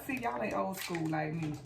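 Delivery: ordinary talk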